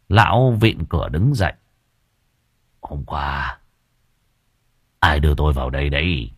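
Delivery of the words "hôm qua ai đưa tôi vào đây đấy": put-on shaken old man. Shaken old man after fainting.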